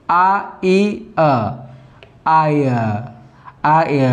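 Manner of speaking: आ ई अ spelt out very slowly, then आय; slow triphthong. Shown as a demonstration. Teacher building the sound step by step, slow. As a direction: break आ ई अ into parts, then blend into आय. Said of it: "इस तरह से".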